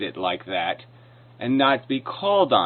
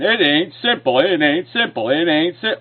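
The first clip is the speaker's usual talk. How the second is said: higher, sing-song mock repetition; mocking imitation; 4 semitones higher than usual. Debater throwing the opponent's objection back at him, mocking. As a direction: parrot an opponent's objection with scorn. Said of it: "You're saying".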